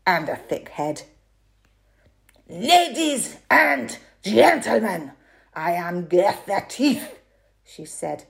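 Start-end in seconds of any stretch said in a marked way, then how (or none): 2.5-7.2 loud, stern strongwoman voice; strict. A narrator's reading. Strongwoman introduces herself sternly to the audience, loud and strict. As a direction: announce yourself sternly to the crowd. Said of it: "She sounded strict".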